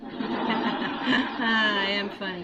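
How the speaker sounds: chuckling through the words; slow, amused, laughing as she says it; laughing at herself. Real, not acted. Praising her own joke while she laughs. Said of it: "I just laugh at myself".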